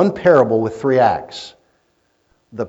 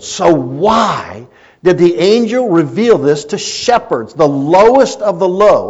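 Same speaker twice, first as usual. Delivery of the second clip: louder, higher preaching from the pulpit; full-force preaching; 6 semitones higher, 7 dB louder than usual. Acted. Pastor preaching to the congregation, loud and fervent. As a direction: drive the sermon home to the congregation.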